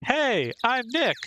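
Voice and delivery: slow, high, drawn-out Nick. Acted. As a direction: stretch out the name for the mic. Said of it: "Maybe I'm not saying it loud enough".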